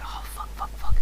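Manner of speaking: breathless whispered swearing, barely audible; panicked whisper. Retold or imitated. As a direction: hiss the curses under your breath in panic. Panicking outside friend's house.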